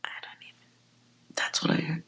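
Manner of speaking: breathy whispered aside to viewers. Real, not acted. Reader confiding to viewers, in a whisper. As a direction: drop to a secret whisper, half amused.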